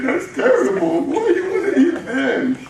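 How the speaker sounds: a small fox, appalled but giggling; young fox gasping at the wolf; shocked little fox. Acted.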